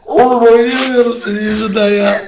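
loud, high, laughing complaint. Real, not acted. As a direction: say it through a fit of laughter. Laughing fit.

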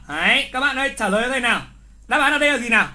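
ordinary talk, nothing marked

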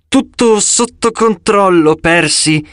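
strained gritted-teeth repeat; through gritted teeth. A narrator's reading. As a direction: force it out through clenched teeth. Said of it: "ripeté lei a denti stretti".

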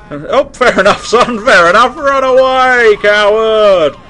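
loud jeering shout after a fleeing enemy; playful loud taunt; mock taunt. Real, not acted. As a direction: yell it high and mocking.

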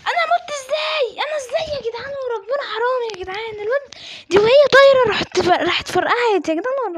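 high, loud cry of disbelief; worked-up, high-pitched complaining; outraged protest. Real, not acted. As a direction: wail that it is so unfair. Protesting a sudden in-game death.

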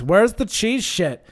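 high, loud, drawn-out demand; slow, swooping, hammed-up demand; mock demanding yell. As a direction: imitate a pushy woman hollering for food. Imitates woman demanding cheese.

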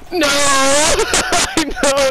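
loud laughing shout; excited yell. Real, not acted. Excited yelling after play.

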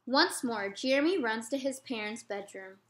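ordinary delivery, nothing marked

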